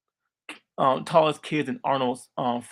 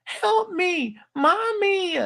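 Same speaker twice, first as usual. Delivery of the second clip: high-pitched, drawn-out cry for mom; hammed-up cowardly wail, pitched way up; crying for mommy; much slower than usual. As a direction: wail like a scared boy calling his mother. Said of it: "he'll always cry to his mom".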